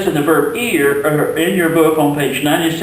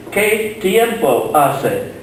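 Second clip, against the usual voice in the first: slow, carefully articulated question for learners; slow Spanish question; 4 semitones higher, much slower than usual. Shown as a demonstration. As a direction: model the question slowly for the class. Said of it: "here's another phrase".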